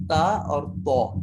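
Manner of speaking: تا and تو said slowly and clearly, each sound spelled out apart; slow out تا then تو so the gap between them is heard; letter sound demo. Shown as a demonstration. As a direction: tutor drawing out تا and تو to contrast them. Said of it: "اس میں تھوڑا سا فرق آپ کو نظر آ رہا ہوگا".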